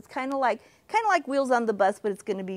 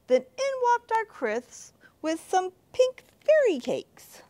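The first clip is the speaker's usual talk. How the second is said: excited narration; 12 semitones higher than usual. A narrator's reading. Narrator builds to Chris's entrance.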